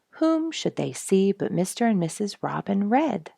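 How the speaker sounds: ordinary delivery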